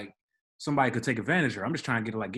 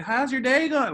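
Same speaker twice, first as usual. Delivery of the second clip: mock drunk resident, much higher and louder; drunk woman; 13 semitones higher, 6 dB louder than usual. Drunk resident greeting the concierge, mocked high and loud. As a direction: ham up a tipsy woman gushing hello. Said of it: "she's speaking like".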